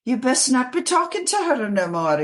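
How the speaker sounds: a hint of a strict foster mother warning; scolding Mama Koss. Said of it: "She goes".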